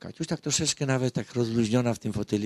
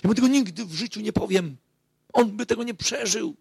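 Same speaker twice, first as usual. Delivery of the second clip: high, loud exclamation of refusal; high, loud retold cry of a wife refusing; retold outcry; 10 semitones higher, 3 dB louder than usual. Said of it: "wykrzyknęła".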